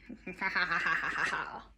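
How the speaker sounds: low cackling villain laugh; evil laugh. Pretend. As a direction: laugh like a wicked witch. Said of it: "that's not a witch laugh. That's more like an evil laugh".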